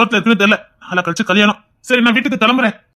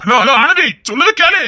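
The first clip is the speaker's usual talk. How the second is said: urgent raised calling down the phone; 5 semitones higher than usual. Acted. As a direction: shout to be heard before the line drops. Shouting into a dropping phone call.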